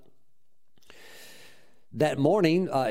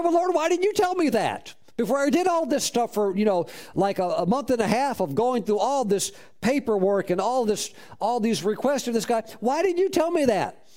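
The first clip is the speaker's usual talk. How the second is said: high, pleading why-didn't-you-tell-me voice; exasperated complaint; 7 semitones higher than usual. Retold or imitated. Minister to the Lord after the deal, high exasperated plea. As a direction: complain to God, voice pitched way up in disbelief.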